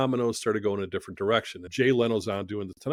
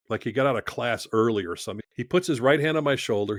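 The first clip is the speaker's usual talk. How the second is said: normal talking voice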